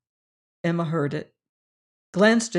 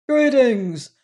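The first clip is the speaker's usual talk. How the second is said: loud, cheery boom, higher; booming greeting; 5 semitones higher, 5 dB louder than usual. A narrator's reading. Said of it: "boomed his cheery vision".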